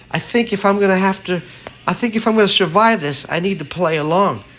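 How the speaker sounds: high, fast, nervous inner thought; young woman's voice. A narrator's reading. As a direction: rush it like a scared young woman.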